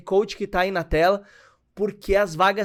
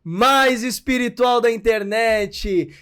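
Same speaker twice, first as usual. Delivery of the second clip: bright, higher and louder host welcome, last line slow; upbeat show opening; 5 semitones higher, 5 dB louder, slower than usual. Acted. Host welcoming viewers to the podcast, upbeat announcer read. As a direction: greet the audience like a show announcer.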